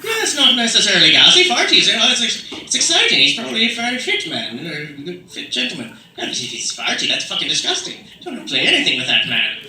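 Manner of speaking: put-on animated Irish accent, starting high and loud; Irish pub-argument voice. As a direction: bicker in an Irish accent, settling down as it goes. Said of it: "Then if you're in Ireland".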